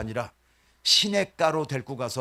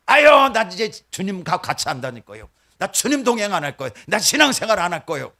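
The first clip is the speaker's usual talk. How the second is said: loud, high sulky protest; petulant outburst; 5 semitones higher, 9 dB louder than usual. Pretend. Believer telling the Lord they're done, a loud sulky tantrum. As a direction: stamp your foot and quit. Believer sulking, quitting faith.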